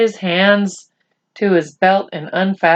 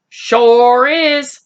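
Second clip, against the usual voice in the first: higher, louder cowboy drawl; cowboy replying, higher and louder; Boone's voice; 6 semitones higher, 5 dB louder than usual. Acted. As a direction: answer like a proud old cowboy. Boone answers Omri.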